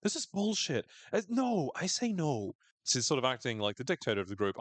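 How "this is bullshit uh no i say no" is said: high-pitched, petulant refusal; pulling rank. Retold or imitated. Captain overruling the group, mock-indignant high voice. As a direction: put your foot down, hammed-up. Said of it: "like, pulling rank as the captain".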